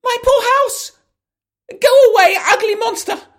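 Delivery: high, loud squeaky outrage; rabbit shouting. Acted. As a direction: yell at the monster in your house.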